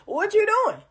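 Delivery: mock-baffled high "What you doing?"; high incredulous. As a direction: squeak it out in disbelief.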